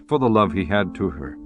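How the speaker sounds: ordinary delivery, nothing marked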